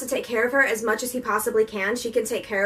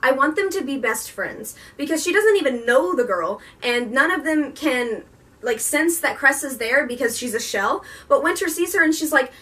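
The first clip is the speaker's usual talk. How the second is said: ordinary talk, nothing marked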